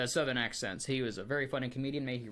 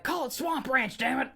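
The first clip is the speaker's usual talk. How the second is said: much higher and louder, a hammed-up furious shout; mock angry yell; 12 semitones higher, 4 dB louder than usual. Imagining the skunk ape yelling at the ranch, mock furious shout. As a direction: bellow it like a fed-up swamp creature.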